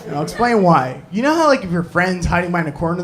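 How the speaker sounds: ordinary talk, nothing marked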